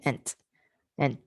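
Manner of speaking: "Ent" said alone twice, clear T; ent sound. Shown as a demonstration. Teacher drills the word ending, clear and slow. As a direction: model "ent" for the class. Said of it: "the consonant cluster sound".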